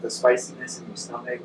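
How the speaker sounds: normal talking voice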